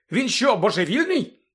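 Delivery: high, loud incredulous question; can't believe his ears; astonished. A narrator's reading. Ron to Lupin, incredulous, high and loud. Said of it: "здивувався Рон".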